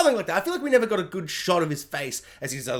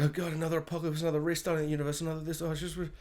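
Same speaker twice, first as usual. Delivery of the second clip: mock annoyed groan; 9 dB quieter, faster than usual. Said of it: "really annoyed and be like".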